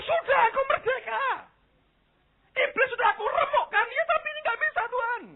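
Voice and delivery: very high, strained mock prayer cry; imitated yelling. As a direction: mimic frantic prayer shouting on one high pitch. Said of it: "kita mau teriak-teriak". (menirukan teriakan doa bernada melengking)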